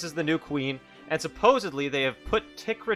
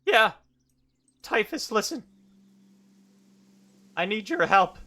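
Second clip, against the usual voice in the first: put-on earnest young hero; 9 semitones higher, 5 dB louder than usual. Hero pleads with his mentor.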